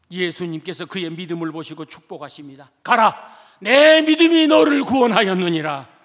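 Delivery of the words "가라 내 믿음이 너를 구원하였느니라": louder, solemn pronouncement; solemn blessing. Acted. Jesus blessing the healed beggar, solemn and loud. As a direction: bless him with authority.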